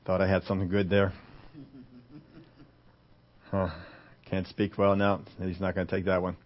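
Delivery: low, soft, deflated thinking aloud; muttered private thought. Acted. Moses reckoning with his failed excuse, muttered low. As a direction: mumble it privately with pauses. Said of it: "Moses is only thinking one thing".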